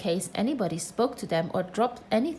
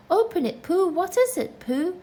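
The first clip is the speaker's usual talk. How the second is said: excited clamour; 9 semitones higher, 4 dB louder than usual. Acted. Everyone clamouring at Pooh.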